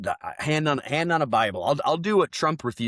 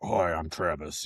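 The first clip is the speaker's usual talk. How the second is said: slowed, lower, put-on polite introduction; stiff suit guy; 6 semitones lower, 4 dB quieter, slower than usual. Man in a suit introduces himself, stiff and slow. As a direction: play the buttoned-up man in a suit. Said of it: "I feel like I'm just like".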